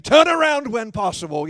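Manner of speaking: loud, high, snappy sat-nav lady; irritated GPS voice. Retold or imitated. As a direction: bark the order like an annoyed navigation system. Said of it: "a G P S with an irritated woman".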